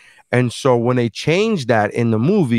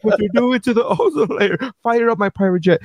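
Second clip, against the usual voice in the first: much higher, whining mock-tearful voice; hammed-up high whimper through a laugh; Leonardo DiCaprio mock lament; 9 semitones higher than usual. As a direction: play a teary celebrity fretting. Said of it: "He's like".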